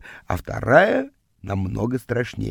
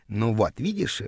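hushed, lower and softer; relieved whisper; 3 semitones lower, 7 dB quieter than usual. A narrator's reading. Girl hiding from the visitor reassures boy, relieved whisper. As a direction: murmur it with understated relief. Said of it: "облегчённо прошептала она".

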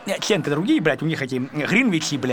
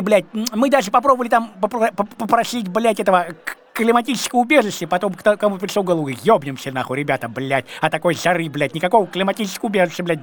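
ordinary delivery

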